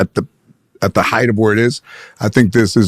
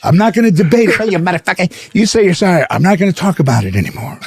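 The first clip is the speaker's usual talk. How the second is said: mock furious yell; 9 semitones higher than usual. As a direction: scream it like a movie gangster. Pesci arguing in retold fight.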